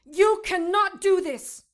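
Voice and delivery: furious shout. Acted. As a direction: scream defiance at him. Captive shouting at captor.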